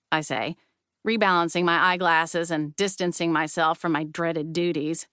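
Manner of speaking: usual speaking voice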